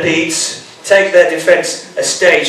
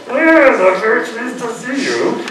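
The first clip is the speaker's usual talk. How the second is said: high-pitched, put-on pleasure through a frozen grin; mock delight with a stuck grin; squeezed, falsely pleased mother-in-law; fixed rictal grin; 8 semitones higher than usual. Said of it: "Perfect for fixing that rictal ... grin".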